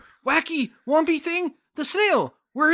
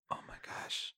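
hushed whispered oh my gosh; 22 dB quieter than usual. Real, not acted. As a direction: breathe it in a whisper. Whispered reaction.

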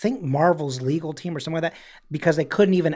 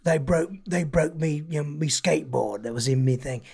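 mock London accent. Jason Statham griping to fans about his luggage, mock London accent. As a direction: do a London geezer moaning about lost luggage. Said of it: "he doesn't say me".

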